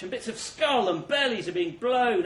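ordinary talk, nothing marked

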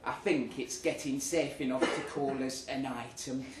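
ordinary delivery

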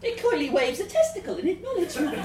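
narrating through laughter; barely holding back laughter; laughing. A narrator's reading.

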